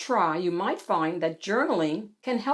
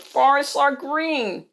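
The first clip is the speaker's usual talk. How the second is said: high, slow, insistent protest; squeal; 6 semitones higher, 3 dB louder than usual. A narrator's reading. Seymour insisting on how forests look, squealed complaint. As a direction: draw out a squealed objection. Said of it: "Seymour squealed".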